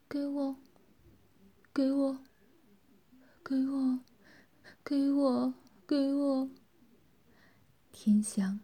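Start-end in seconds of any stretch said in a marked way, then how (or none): 0.1-6.5 音调偏高，平平地一遍遍念叨; 像着了魔一样反复低念; 着魔般喃喃. A narrator's reading. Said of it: "口中只是喃喃地说道". (着魔似地喃喃自语)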